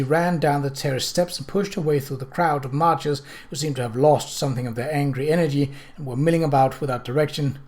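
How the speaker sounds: ordinary delivery, nothing marked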